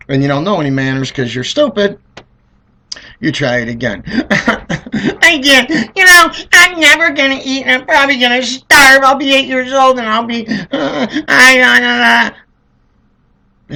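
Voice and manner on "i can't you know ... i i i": put-on high, loud whining child voice; whiny kid. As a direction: whine dramatically about starving. Kid whining about starving.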